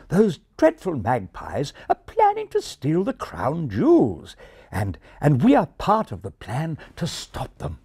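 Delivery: a hint of English indignation, refined; refined English accent. A narrator's reading. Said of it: "continued the English pigeon".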